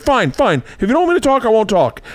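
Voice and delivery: high, hurt little-brain voice; sulky little brain. Retold or imitated. Little brain sulking after big brain shuts him up, high hurt voice. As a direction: give in with a high wounded sulk. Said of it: "little brain's like".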